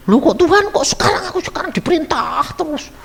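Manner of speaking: high mock complaint; a hint of a whining wife; grumbling wife. Wife complaining to God about being ordered around, mock whine. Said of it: "kok kamu jadi ngomel".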